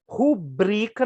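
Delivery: "Rubrica" slowly, with the weight on BRI; stress on BRI. Shown as a demonstration. Teacher introducing the correct pronunciation, stretched stress on BRI. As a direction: lean on the middle syllable of "Rubrica". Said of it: "é uma paroxítona".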